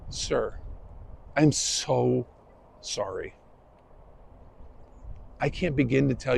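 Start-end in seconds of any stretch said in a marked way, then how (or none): 0.1-3.3 soft, much quieter, sincere; calm him down gently; hushed, heartfelt sorry; humble apology. Retold or imitated. Apology to angry driver, retold.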